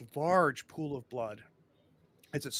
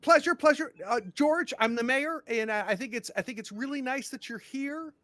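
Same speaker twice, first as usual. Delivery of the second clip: much higher, flustered mayor backpedalling; mayor recovering from rejected hug, high and flustered; startled eager mayor; 10 semitones higher, 5 dB louder than usual. Acted. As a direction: cover the awkward hug, flustered.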